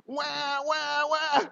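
high newborn "wah" cries; imitated baby wail. Father imitating his baby's first cries, high wailing.

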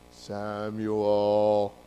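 low, drawn-out booming God calling a name. Acted. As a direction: intone the name slowly and deep. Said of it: "That's my God voice".

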